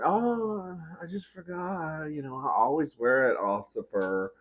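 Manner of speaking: high, soft feigned innocence; drawn-out, innocent excuse that eases back down; playing it off. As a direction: act sheepishly forgetful. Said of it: "I played it off".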